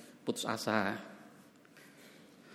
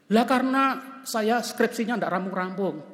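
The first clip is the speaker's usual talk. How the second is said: student complaining; 8 semitones higher, 6 dB louder than usual. Retold or imitated. Pastor voices student again.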